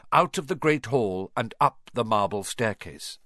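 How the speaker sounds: plain reading voice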